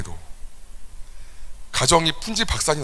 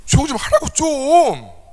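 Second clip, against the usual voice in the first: high, loud furious yell; angry yell; 12 semitones higher, 6 dB louder, slower than usual. Retold or imitated. Pastor reliving yelling at his son at night, angry shout. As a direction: shout it at the noisy kid. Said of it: "제가 그랬어요".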